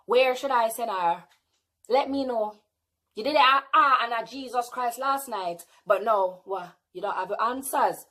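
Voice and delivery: ordinary talk, nothing marked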